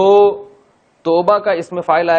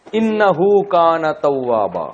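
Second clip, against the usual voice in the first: recitation; 4 semitones higher, much slower than usual. Shown as a demonstration. Recites the verse.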